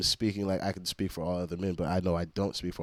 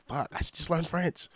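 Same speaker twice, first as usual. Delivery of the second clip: higher, exasperated self-quote; frustrated outburst; 9 semitones higher than usual. Retold or imitated. Streamer retells his reaction to untranslated French, exasperated. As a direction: throw it up like a fed-up sigh at yourself. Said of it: "I always be like".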